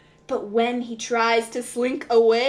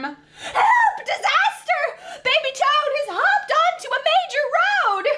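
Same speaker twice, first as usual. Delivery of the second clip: high, urgent cries for help; panicked cry; 13 semitones higher than usual. Acted. Creatures crying out in alarm, high and urgent. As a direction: shout for help as disaster strikes.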